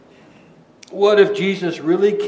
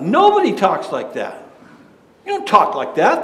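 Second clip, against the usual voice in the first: normal talking voice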